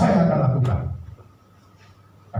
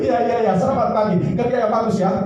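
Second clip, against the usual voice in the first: laughing; 7 semitones higher, faster than usual. Acted. Laughing boss greeting.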